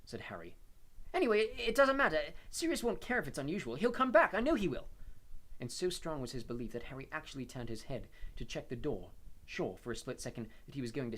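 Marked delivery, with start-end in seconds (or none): 1.1-4.9 put-on high, loud, insistent boy's voice; desperate teenage boy. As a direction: insist against all doubt.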